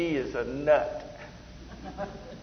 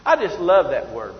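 normal talking voice

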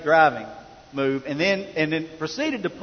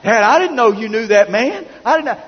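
high, loud mock-rattled voice; shaken son; 6 semitones higher, 8 dB louder than usual. Son calling Dad after being pulled over, shaken. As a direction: imitate a rattled boy phoning his father. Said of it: "still had a shiver in his voice when he called me".

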